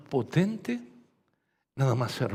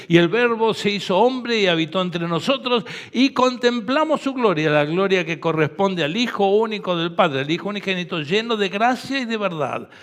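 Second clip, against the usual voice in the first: louder, higher preaching at full force; fervent preaching; 4 semitones higher, 9 dB louder than usual. Acted. Pastor preaching on John 1 to the congregation, full-force preaching. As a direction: drive the message home to the congregation.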